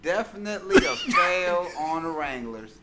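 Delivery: loud, amateur Southern attempt; Southern accent. Pushed by friends to read a tweet, in a shaky Southern accent. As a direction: have a go at a Southern accent, half laughing. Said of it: "Let's get your Southern accent".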